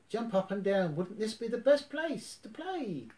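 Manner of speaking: high, eager little voice; bubbling with excitement to play; excited Piglet calling to Tigger. Acted. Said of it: "cried Piglet".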